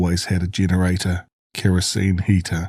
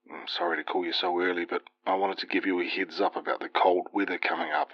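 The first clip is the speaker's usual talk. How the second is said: quiet father voice; 8 dB quieter, faster than usual. Acted. Dad on the phone, early morning.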